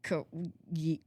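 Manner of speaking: ordinary talk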